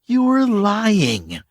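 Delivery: very slow, high, cold accusation; accusing. Acted. K J calling out the stranger's lie, slow and cold. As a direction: lay it on him word by word.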